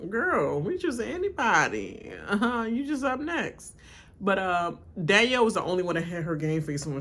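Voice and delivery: ordinary delivery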